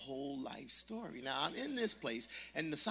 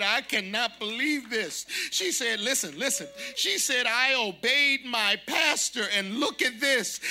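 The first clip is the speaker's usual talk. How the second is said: louder, higher excited gushing; loud thrilled testimony; loud overjoyed; 10 semitones higher, 12 dB louder than usual. Retold or imitated. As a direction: gush like someone just blessed. Overjoyed waitress keeps talking.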